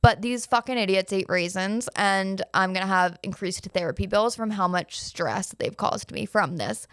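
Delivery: ordinary talk, nothing marked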